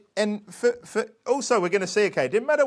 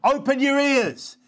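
high, raised, slow call; yelled command; 7 semitones higher, 4 dB louder, slower than usual. Acted. Preacher voicing Jesus yelling at listeners, high and slow. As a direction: yell it at a crowd that won't listen. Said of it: "He yelled at them".

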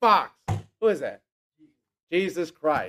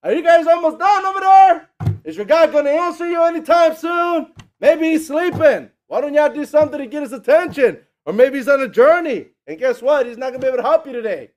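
much higher, loud shouted mockery; ripping on them; 10 semitones higher, 9 dB louder than usual. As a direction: heckle them from across the way. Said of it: "Elijah's just over there ripping on you".

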